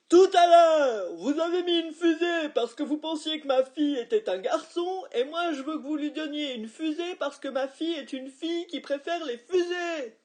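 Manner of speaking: very loud, high furious bellow; out-shout her; dad bellowing. A narrator's reading. Dad bellowing over the waitress, very loud. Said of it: "papa a hurlé".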